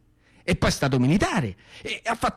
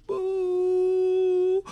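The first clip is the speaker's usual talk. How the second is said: high droning buzz of a warplane; imitated plane roar; 12 semitones higher than usual. Boy mimicking a warplane roaring overhead, high buzz. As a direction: drone it like a fighter plane overhead.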